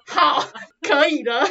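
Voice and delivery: slow, laughing through the words. Retold or imitated. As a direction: laugh it out slowly. Retold reply, laughing. (边笑边慢慢说)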